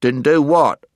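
pointed character question; Puddleglum. A narrator's reading. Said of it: "asked Puddleglum".

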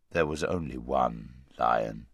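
low, slow, huge voice of a great lion; large deep voice. A narrator's reading. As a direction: speak low and vast, unhurried. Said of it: "said the voice".

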